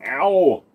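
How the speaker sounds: loud sharp yelp of pain. Real, not acted. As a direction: cry out from a leg cramp. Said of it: "My legs are killing the shit out of me".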